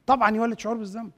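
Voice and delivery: ordinary talk, nothing marked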